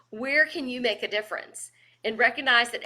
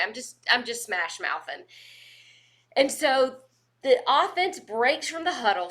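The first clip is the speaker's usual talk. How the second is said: usual speaking voice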